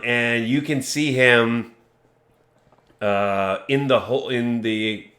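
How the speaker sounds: ordinary talk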